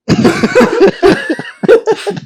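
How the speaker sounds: a high, loud burst of disbelieving laughter; incredulous laugh. Real, not acted. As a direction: laugh out at the absurd question. Friend asks about beer mid-crisis.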